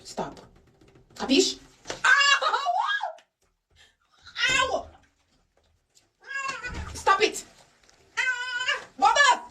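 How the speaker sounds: high yelps of stop and ow; pleading high with a squirming dog; restraining a pet. Real, not acted. Dog fights the bath.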